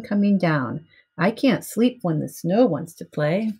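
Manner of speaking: plain reading voice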